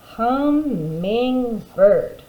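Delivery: very slow Hummingbird, each part drawn out; syllables blended slowly. Shown as a demonstration. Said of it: "Last part".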